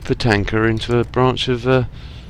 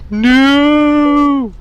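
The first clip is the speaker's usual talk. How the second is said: long, loud, high-pitched no; hammed-up wail held on one high note; mock despairing cry; 7 dB louder than usual. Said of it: "and I'll be like".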